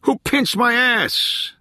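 very high, drawn-out playful shriek; hammed-up joking yell, pitched far up; mock scream. A narrator's reading. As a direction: squeal it slowly in pretend outrage. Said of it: "let out a mock scream".

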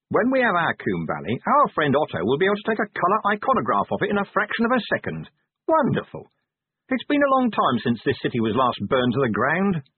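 bitter irony, hammed-up cheer; sarcastic. A narrator's reading. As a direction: sneer through feigned enthusiasm. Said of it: "sarcasm into his tone".

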